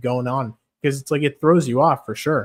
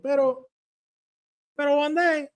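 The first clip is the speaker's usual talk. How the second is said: Brandon Moreno. Retold or imitated. Fighter vowing to become champion, high Mexican accent. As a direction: proclaim the future title like a Mexican fighter. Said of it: "He's like".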